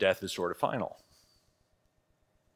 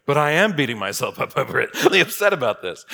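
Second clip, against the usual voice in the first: loud, raised-pitch protest breaking into laughter; hammed-up indignant retort, much louder; mock-upset outburst; 6 semitones higher, 10 dB louder than usual. Guilt-ridden person protesting "don't beat yourself up", mock-upset outburst. Acts out indignant reply to soothing advice.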